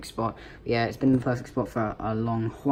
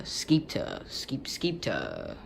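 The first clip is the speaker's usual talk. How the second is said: skeeter muttered softly while unsure; mumbled guessing; 4 semitones lower, 6 dB quieter, much slower than usual. Real, not acted. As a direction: quietly try skeeter to yourself. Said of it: "I can't read".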